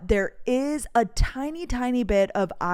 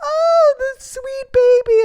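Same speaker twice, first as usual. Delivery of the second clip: cooing; 9 dB louder, slower than usual. Real, not acted. Melting over her drugged dog, high cooing. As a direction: coo like over a puppy.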